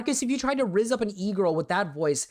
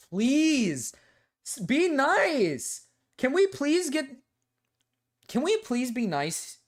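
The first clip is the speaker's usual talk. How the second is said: whiny plea; 9 semitones higher, slower than usual. Real, not acted. Pleading after being teased.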